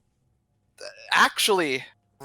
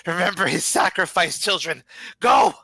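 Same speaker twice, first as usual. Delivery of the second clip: raised, louder call ending in a shouted order; elder's rallying shout; 4 semitones higher, 4 dB louder than usual. Acted. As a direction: shout it to the children, building to a loud final word. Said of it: "the head elder just says".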